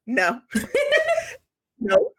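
high, loud, laughing refusal. Retold or imitated. Group members refusing infiltrators, loud and laughing. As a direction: shut the intruders out with a laughing shout.

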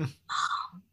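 soft, voiceless whisper; breathy imitation of a son's whisper; imitated whisper. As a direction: whisper it like a child at the bedside. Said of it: "I heard his voice whisper".

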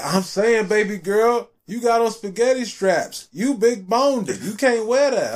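hammed-up smooth operator giving bad advice; smooth pickup guy. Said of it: "he was like".

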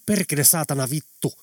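restrained narrated roar of frustration; roared curse. A narrator's reading. Veera venting her despair by the station, half-roared curse. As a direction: curse forcefully, letting the pressure out. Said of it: "karjasi sitten niin".

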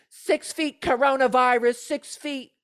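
high, loud nagging imitation; mock coronavirus scolder. Commentator mimicking the woman yelling six feet, mock scolding. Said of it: "this person going the".

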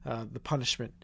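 real upset, voice dropping low and soft; emotional. Real, not acted. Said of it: "I apologize for getting a little emotional".

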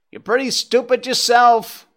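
high, loud crow's retort; calling down. A narrator's reading. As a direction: call it down from a treetop. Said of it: "the crow called down from above them".

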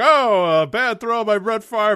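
high, loud, slow mock broadcaster; professional announcer. Friend mocking a calm professional announcer, stiff and polished. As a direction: put on a stiff polished booth voice. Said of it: "professional announcer guy being like".

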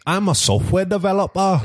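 high imitation of a Geordie colleague; hammed-up Newcastle accent. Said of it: "a guy I work with who's Geordie".

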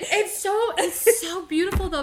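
high, loud emotional gush; overwhelmed and close to tears; trying not to cry. Real, not acted. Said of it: "I'm trying really hard not to cry right now".

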